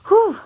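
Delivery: high, loud "whew"; big exhale. Real, not acted. Showing old fandom art, steadying breath after nearly crying. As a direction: blow out a sharp, high sigh.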